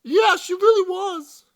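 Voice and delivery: put-on high girly voice. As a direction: squeal it in agreement. Girl agrees in high voice.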